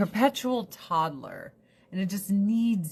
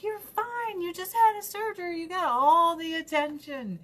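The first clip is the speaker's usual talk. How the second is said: much higher scolding voice to the dog; talking to the dog; 10 semitones higher than usual. Retold or imitated. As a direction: reassure the jealous dog in a high pitch. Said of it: "And I'm and like".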